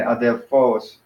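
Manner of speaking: Adelphos, accent landing on PHOS; stress on last syllable. Shown as a demonstration. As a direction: stress the end of Adelphos, not the start. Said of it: "put at the end".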